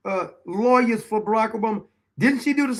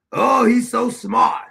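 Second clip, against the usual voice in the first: mock admiration; 4 dB louder, slower than usual. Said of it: "Is anybody still kissing Steve Bannon's ass".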